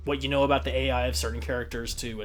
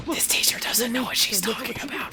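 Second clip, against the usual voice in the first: airy mock whisper, barely discreet; failed whisper; 8 semitones higher than usual. Kid grumbles about teacher, thinking it is whispered. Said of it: "thinking it was whispering".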